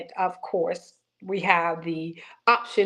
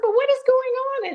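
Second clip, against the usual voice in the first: much higher, a little louder startled question; retold alarm; 3 dB louder than usual. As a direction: ask it as if suddenly worried. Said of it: "And I was like".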